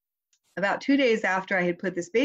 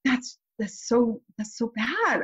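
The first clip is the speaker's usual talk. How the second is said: sympathetic dismay; 5 semitones higher, slower than usual. Retold or imitated. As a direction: act out feeling a child's distress. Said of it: "she was really distressed".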